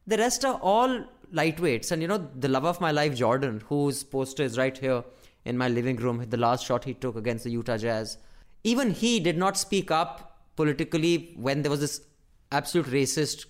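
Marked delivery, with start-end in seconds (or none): none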